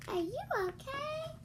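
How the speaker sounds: high concerned question; high caring question; worried friend. Acted. Worried character voice.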